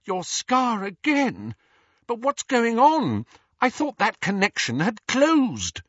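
worried for Harry, voice climbing; frightened voice. A narrator's reading. Hermione alarmed about Harry's scar, high and frightened. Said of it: "as she said in a frightened voice".

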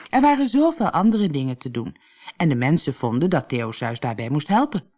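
ordinary delivery, nothing marked

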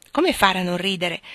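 usual speaking voice

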